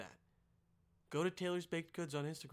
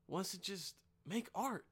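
usual speaking voice

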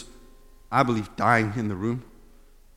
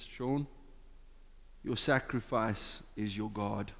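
quiet voice of the Spirit; 4 semitones lower, 12 dB quieter, slower than usual. Retold or imitated. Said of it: "I could clearly hear the Spirit says".

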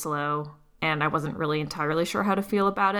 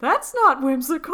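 laughing; 9 semitones higher, 6 dB louder than usual. Retold or imitated. Laughing quote about [name].

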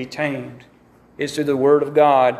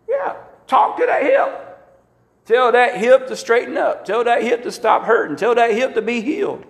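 raised, ringing command to the hip; 6 semitones higher than usual. Acted. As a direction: order the hip to heal, rising high.

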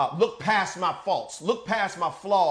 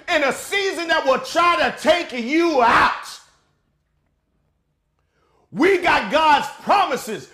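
loud, higher preaching with slow punched lines; full-force preaching; 8 semitones higher, 7 dB louder than usual. Acted. Pastor declaring God's promises, shouting preaching. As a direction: bring the church to its feet.

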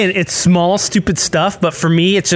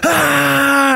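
mock yelp; 9 semitones higher than usual. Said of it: "he goes".

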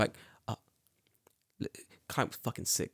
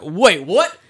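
high, loud "wait, what?"; incredulous outburst; 6 semitones higher, 12 dB louder than usual. Retold or imitated. Told the game changed, loud disbelief. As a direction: thrown by a rule change.

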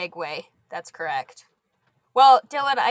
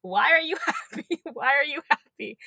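lightly imitated child, higher pitch; giggling child to herself; 5 semitones higher than usual. Teacher imitating two-year-old repeating her joke, lightly giggly. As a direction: repeat it like an amused little kid. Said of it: "she just kept repeating to herself".

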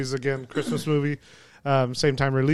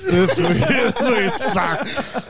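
said through a high laugh; 5 semitones higher, much slower than usual. Real, not acted. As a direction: laugh your way through it, slowly.

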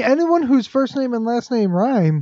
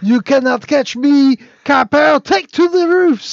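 taunting outlaw; 6 semitones higher, 4 dB louder than usual. Pretend. Mock outlaw taunting police.

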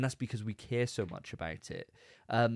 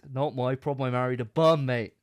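louder mock protest, leaning on bum; a hint of a fed-up husband, louder; mock indignant; 3 semitones higher, 7 dB louder than usual. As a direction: state it flatly like a fed-up husband. Mock husband's defence, first take.

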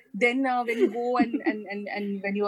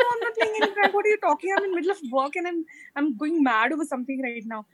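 high-pitched retold protest to dad; exasperated protest; 6 semitones higher, faster than usual. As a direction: act out a harried denial. Retelling exasperated reply to dad.

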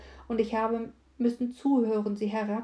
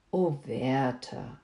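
slow, low and heartfelt; tender; 6 semitones lower, much slower than usual. Acted. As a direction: breathe his name with deep tenderness. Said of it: "mit innigem Tone".

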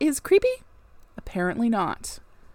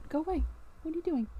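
higher, softer, fond scolding to a pet; shooing the cat; 5 semitones higher, 10 dB quieter, much faster than usual. Real, not acted. Reader shoos her cat off the keyboard, soft and high. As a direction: gently shoo the cat off the keys.

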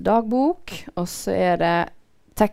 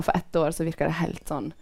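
usual speaking voice

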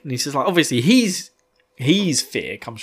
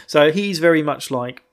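ordinary talk, nothing marked

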